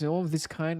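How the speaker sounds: ordinary delivery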